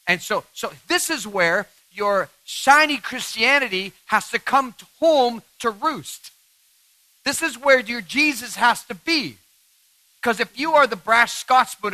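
slow, rising sermon climax; preaching at full force. Real, not acted. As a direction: drive the point home like a preacher.

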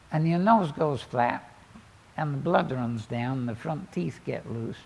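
ordinary talk

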